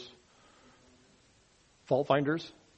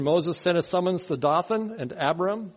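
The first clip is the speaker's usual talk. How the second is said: ordinary talk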